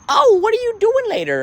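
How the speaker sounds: very high mocking small talk; high-pitched mimicked chitchat; imitated woman asking. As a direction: put on a falsetto, prying question. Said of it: "You'll ask me something like".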